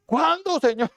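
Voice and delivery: high, pleading cry of ¿Cuándo, Señor?; desperate plea. Retold or imitated. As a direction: plead impatiently upward to the Lord. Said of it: "se van a desesperar de decir". (súplica desesperada y aguda)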